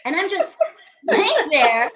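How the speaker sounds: ordinary talk, nothing marked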